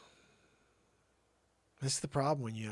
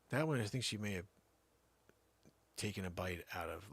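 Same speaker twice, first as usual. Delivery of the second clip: usual speaking voice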